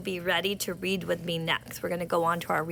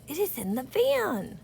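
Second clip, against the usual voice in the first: higher, brighter read-aloud; a bit excited; 7 semitones higher than usual. Shown as a demonstration. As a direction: read it like good news for the class. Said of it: "we're gonna sound a little bit more excited".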